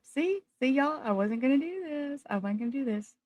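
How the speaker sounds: high, soft voice close to tears; choked up. Real, not acted. Streamer getting choked up before viewers, really tearful. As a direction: fight back tears, voice thin and quiet. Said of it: "I'm getting choked up".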